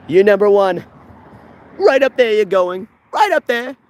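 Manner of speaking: excited thanks. Real, not acted. Loud thanks to a fan.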